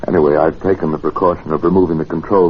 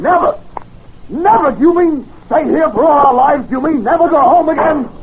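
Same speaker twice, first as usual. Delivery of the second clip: anguished disbelief. Acted. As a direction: wail it like a trapped man. Realising they're stranded forever.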